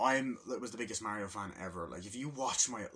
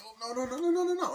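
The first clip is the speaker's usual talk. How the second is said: high, rising louder no no no; panic, climbing higher and louder; mock panic; 8 dB louder than usual. Panic as dog attacks.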